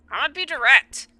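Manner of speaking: sharp, high and loud; loud and direct. Acted. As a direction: come in hard and direct. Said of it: "Okay, be direct".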